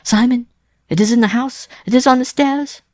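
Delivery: high, loud terrified woman; panicked cry. A narrator's reading. Wife warning Simon of the approaching dog, panicked. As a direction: raise the alarm in frightened bursts. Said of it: "cried with an inarticulate gasp".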